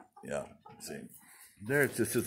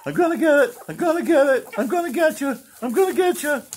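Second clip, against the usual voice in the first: much higher sing-song threat; chase-game teasing, pitched way up; playful taunt; 4 dB louder, faster than usual. Pretend. Teasing the rooster.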